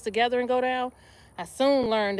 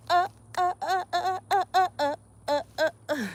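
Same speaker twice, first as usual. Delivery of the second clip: rhythmic high effort grunts with a laugh; straining grunts; 7 semitones higher than usual. Real, not acted. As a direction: grunt through each squat rep. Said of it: "Rudy gave me a laugh".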